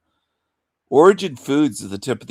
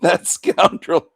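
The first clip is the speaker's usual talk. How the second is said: mock prissy lady; 6 semitones higher, slower than usual. Mocking the woman who left, as an outraged prim lady. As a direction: ham up an offended lady.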